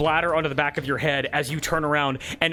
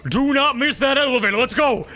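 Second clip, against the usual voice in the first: loud, high order to hurry; urgent shout; 8 semitones higher, 5 dB louder than usual. Acted. Gunslinger urging the player to the elevator, shouting. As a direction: bark it over the chaos. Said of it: "kills the guy right behind you and goes".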